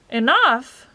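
impatient. A narrator's reading. Magician answering Milo's question, impatient and loud. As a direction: throw the word back impatiently. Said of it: "he said impatiently".

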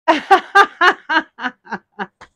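high, loud giggling burst; loud burst of laughter. Real, not acted. Vlogger cracking up after her accent joke, loud laugh. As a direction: crack up at your own silly joke.

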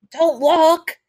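high, loud angry shout. Acted. Shirtless captive shouts at the staring man, high angry yell. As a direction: snap it at him, outraged. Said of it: "you tell him angrily".

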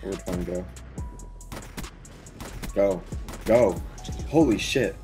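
usual speaking voice

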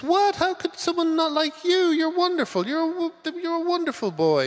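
a doting dad overdoing the reassurance; gushing sitcom dad. Retold or imitated. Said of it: "he says".

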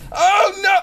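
loud, high "oh, no"; panicked cry. Real, not acted. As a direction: yell in sudden alarm. Cries out at sudden setback.

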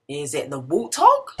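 lively, sing-song picture book reading; reading to children. A narrator's reading. Teacher reads animal rhymes to children, lively sing-song. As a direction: read it bright and bouncy for little ones.

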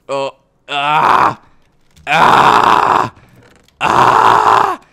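repeated harsh screams of pain. Real, not acted. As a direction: scream out each sting separately. Screaming at the pins.

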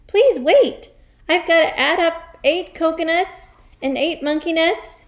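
put-on high, flustered boy's voice; cried out. Flustered boy begs to add up, crying out. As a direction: plead loudly for time to count. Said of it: "he cried".

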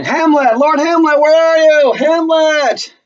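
high, loud calling shout. Acted. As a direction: yell for him across the castle. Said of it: "and they're screaming".

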